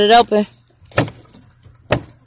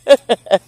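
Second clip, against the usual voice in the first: laughing; 8 semitones higher, 7 dB louder than usual. Real, not acted. Laughing at the rooster.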